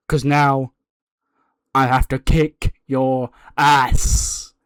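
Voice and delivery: deliberate, spaced-out wrestler's challenge; acted apology building to a punchy threat; mock apology into threat.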